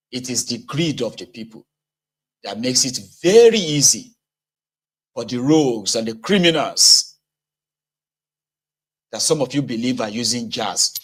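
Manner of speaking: ordinary talk, nothing marked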